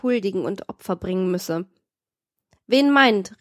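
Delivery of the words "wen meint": loud, higher, booming challenge. A narrator's reading. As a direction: boom it out at him. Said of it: "rief O'Malley mit dröhnender Stimme".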